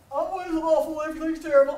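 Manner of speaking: hammed-up moaning; complaining brother. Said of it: "here's how the conversation goes".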